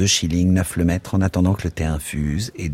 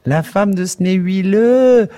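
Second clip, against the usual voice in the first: much higher, louder, slow delighted shriek; 13 semitones higher, 6 dB louder, slower than usual. A narrator's reading. Miss Douce shrieking with laughter at her friend's joke. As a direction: howl it out between laughs. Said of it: "hurla-t-elle".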